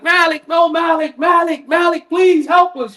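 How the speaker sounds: high shouted pleas for help; anguished screams. Retold or imitated. The damned in hellfire shouting high for Malik. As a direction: scream his name, desperate and begging. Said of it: "screaming for Malik".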